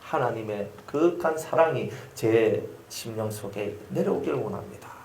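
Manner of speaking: ordinary delivery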